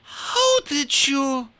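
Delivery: the queen gasping as gold bursts from the floor. Acted. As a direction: caught off guard, breath taken. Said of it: "the queen gasped".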